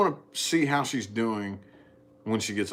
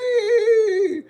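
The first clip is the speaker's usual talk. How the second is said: high, loud crying call of daddy; crying child; 7 dB louder than usual. Retold or imitated. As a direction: imitate a scared little girl calling out. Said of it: "she just sits up and goes".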